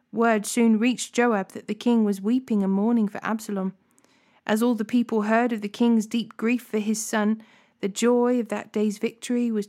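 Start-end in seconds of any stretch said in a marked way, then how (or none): none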